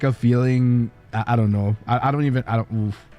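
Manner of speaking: ordinary talk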